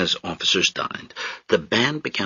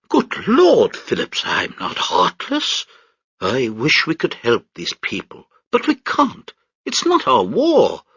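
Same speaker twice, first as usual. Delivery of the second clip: pompous British major; 6 semitones higher than usual. Acted. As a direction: sneer down at the crowd from the deck.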